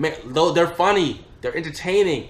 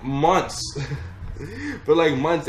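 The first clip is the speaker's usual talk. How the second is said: usual speaking voice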